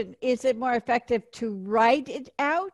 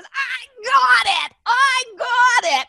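high, loud, drawn-out exclamation; Dee Wallace, excited shouting; 11 semitones higher, 6 dB louder, slower than usual. Retold or imitated. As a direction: imitate someone hollering in excitement, stretching each word. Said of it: "like Dee Wallace".

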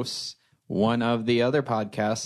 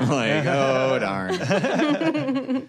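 mock disappointment; 4 semitones higher, much slower than usual. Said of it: "nice cop out".

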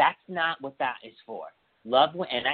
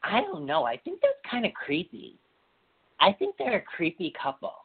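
skeptical self-quote; 10 semitones higher than usual. Retold or imitated. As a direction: voice your unease about them. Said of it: "I'm over here like".

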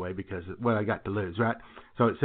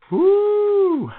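high loud woo from the taste; startled whoop; 10 dB louder than usual. Real, not acted. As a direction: yelp at the awful taste. Reaction to nasty spray.

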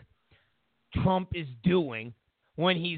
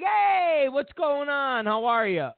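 mock Trump on phone; 7 semitones higher, slower than usual. Trump greeting Taiwan's president on the phone, hearty and high. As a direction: play a hearty caller. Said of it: "And he's like".

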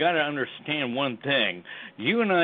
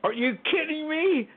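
high, incredulous outcry; mock disbelief; 13 semitones higher than usual. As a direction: play the outraged citizen who can't believe it. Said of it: "The American people are saying".

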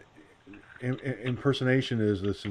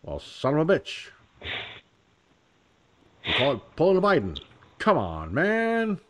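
lightly voiced Joe Biden, a touch higher; a cheesy nod to Biden's folksy grumble; Biden impression; 5 semitones higher than usual. Vlogger imagining Biden hearing the joke, light impression. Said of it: "he'd be like".

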